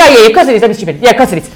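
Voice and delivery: fiancée laughing her answer to the proposal; laughing yes. Retold or imitated. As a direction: giggle out an obvious yes. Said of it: "I went".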